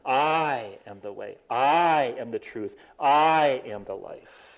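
"I" leaned on, slow and deliberate each time; stress on I. Shown as a demonstration. Preacher shows how Jesus really meant it, stressing "I". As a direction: hit the word "I" in every phrase, slowly. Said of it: "That's the idea".

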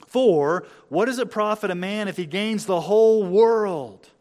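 loud, high, emphatic verse reading; slow it down and proclaim it forcefully; ringing pulpit proclamation; preaching at full force. Acted.

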